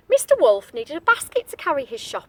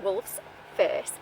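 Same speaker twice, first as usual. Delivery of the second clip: ordinary delivery, nothing marked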